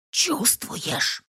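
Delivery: put-on soft hushed old woman; whispering. A narrator's reading. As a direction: whisper it, glancing around. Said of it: "шепчет Рудольфовна".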